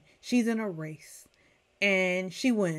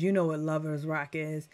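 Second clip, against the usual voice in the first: usual speaking voice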